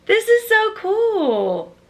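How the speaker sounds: high, slow, gushing delight; squealing with real delight; thrilled. Real, not acted. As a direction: let the joy lift it high and slow. Reacting to a gift.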